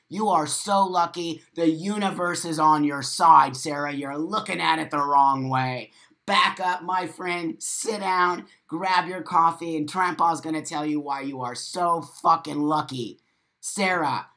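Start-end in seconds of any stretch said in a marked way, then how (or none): none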